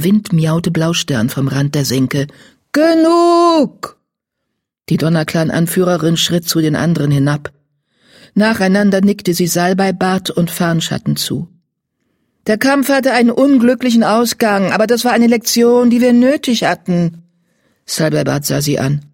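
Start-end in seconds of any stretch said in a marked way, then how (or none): 2.7-4.0 loud, high, drawn-out cry; commanding call. A narrator's reading. Blaustern stops the quarrel from the hollow's edge, commanding call. Leader cuts off quarrel through wind. 12.5-17.2 higher, emphatic leader's voice; firm, commanding. A narrator's reading. Blaustern addresses the quarrelling cats, firm and commanding.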